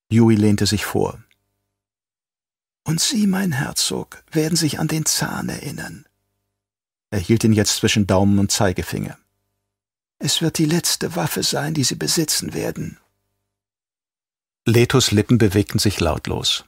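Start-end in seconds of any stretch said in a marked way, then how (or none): 2.8-6.1 a touch higher, calm traitor doctor; soft, quietly fanatic Yui; lightly voiced traitor doctor. A narrator's reading. Yui repeats the tooth. 10.2-13.0 a touch higher, calm traitor doctor; soft, quietly fanatic Yui; lightly voiced traitor doctor. A narrator's reading. Yui hands over the tooth.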